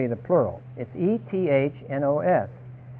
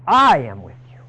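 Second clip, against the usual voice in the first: louder, firm I am with you; loud promise; 4 semitones lower, 7 dB louder than usual. Shown as a demonstration. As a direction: ring it out with conviction. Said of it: "repeats the promise softly, then loudly".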